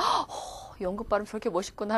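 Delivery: soft awed gasp, then hushed; admiring student. Acted. Teacher playing students impressed by British accent, soft awe. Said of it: "두 부류의 학생들이 있어요".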